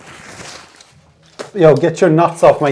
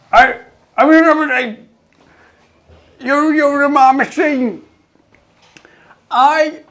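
much higher, slow comic squeak; squeaky mock threat; 13 semitones higher, 5 dB louder than usual. Gym-goer squeaks a comic threat about his machine.

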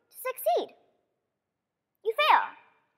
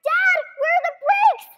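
high loud yelling; panicked shout; 11 semitones higher, 7 dB louder than usual. Retold or imitated. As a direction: scream for Dad while hurtling downhill. Out of control on skis.

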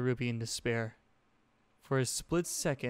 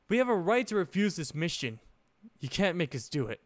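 higher, louder defiant refusal; angry; 6 semitones higher, 4 dB louder than usual. A narrator's reading. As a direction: push back hard. Yang refuses the headmaster.

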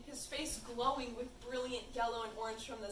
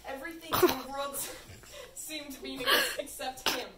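narration breaking into loud giggles; laughing while reading; 2 semitones higher, 6 dB louder than usual. Real, not acted. Reader cracking up over Lewis's besotted thoughts, laughing. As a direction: try to read on through the laughter.

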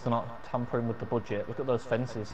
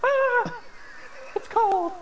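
high yelp, playful; mock shriek; much slower than usual. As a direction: shriek like freezing water hit you. Mock reaction to nude scene.

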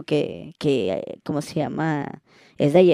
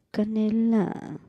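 coy reply; 6 semitones higher, 5 dB quieter, much slower than usual. Retold or imitated.

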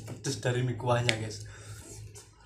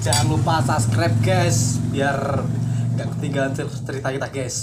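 shouted, higher and much louder; loud call out; 3 semitones higher, 10 dB louder than usual. Real, not acted. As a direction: shout the subscribe call to viewers. Calling viewers to subscribe.

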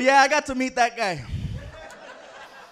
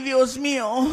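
normal talking voice

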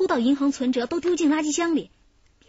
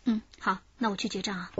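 normal talking voice

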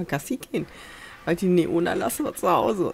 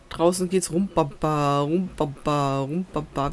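slow sing-song rumbaba like a brass band; imitating festival music; 3 dB louder, slower than usual. Streamer imitating the marching band outside, slow sing-song. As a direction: mimic the oompah band outside. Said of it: "Wir haben ein Schützenfest".

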